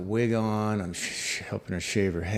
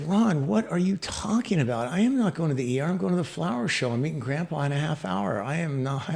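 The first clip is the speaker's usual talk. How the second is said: higher, indignant retort in a quick rush; re-enacted stubborn refusal, pitched up; indignant protest; 6 semitones higher than usual. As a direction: push back in disbelief at the order. Acted indignant refusal to his doctor friend.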